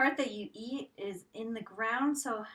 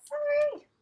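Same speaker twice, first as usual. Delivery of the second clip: loud squeal; 11 dB louder than usual. Real, not acted. Sudden loud exclamation.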